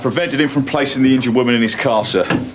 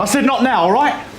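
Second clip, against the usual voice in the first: higher-pitched, testy repeat; exasperated brush-off, pitched up; irritated snap; 9 semitones higher than usual. Acted. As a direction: shut them down, out of patience. Repeats brush-off to persistent colleague.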